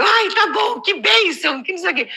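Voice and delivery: high, mock-resigned rejoicing; comic high-pitched "fine, what a blessing"; put-on gratitude. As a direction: give in with exaggerated, forced praise. Gives in to trainer with praise.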